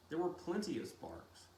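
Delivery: ordinary delivery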